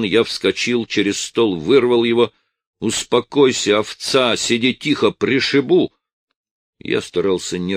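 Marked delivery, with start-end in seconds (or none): 2.8-5.9 threatening shout. A narrator's reading. Husband threatens wife.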